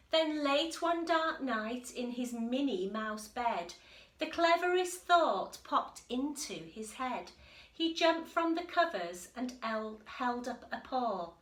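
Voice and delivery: story narration. A narrator's reading. Teacher reading the picture book to the class, lively storytelling. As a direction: read it to the children with bounce.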